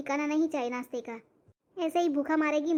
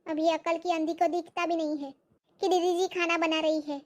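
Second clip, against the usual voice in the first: ordinary talk, nothing marked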